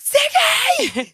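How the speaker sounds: high-pitched retold shriek of a horrified parent; freaking out. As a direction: shriek the name sky-high, re-enacting parental panic. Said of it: "Like, freaking out".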